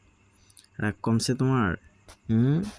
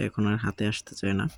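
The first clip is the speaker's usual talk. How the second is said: usual speaking voice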